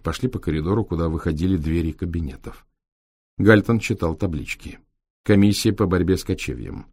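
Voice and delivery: plain reading voice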